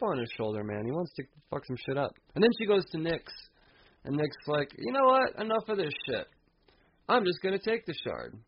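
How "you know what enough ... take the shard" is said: higher, fed-up villain; exasperated cosmic villain; high mock villain voice. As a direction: pitch it up and snap impatiently. Nyx loses patience.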